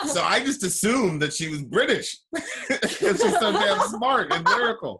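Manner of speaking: high-pitched, laughing through the words; laughing while talking. Real, not acted. Host teasing about assuming she was British, laughing. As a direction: let laughter break up the joke.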